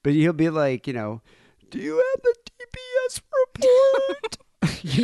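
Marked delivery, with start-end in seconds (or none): 1.7-4.4 high, strained, running out of air; breathless executive voice. Retold or imitated. A VP talking to Kate, breathless and high. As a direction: squeeze the words out on no breath. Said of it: "can't get a whole sentence out without losing his breath".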